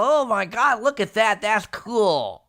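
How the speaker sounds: high, loud hammed-up awe; mock amazement. Pretending to marvel at the stork bringing babies, hammed-up awe. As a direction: gush like you just saw the stork. Imitating awed reaction to stork.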